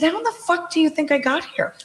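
high-pitched, disbelieving comeback; incredulous retort. Retold or imitated. Senator retelling her retort to a lobbyist, high and incredulous. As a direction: fire it back at him, stunned he'd ask.

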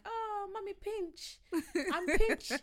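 put-on child calling to mummy, laughing; toddler son. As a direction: coo it like a proud toddler. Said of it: "he's like".

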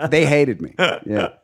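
ordinary talk, nothing marked

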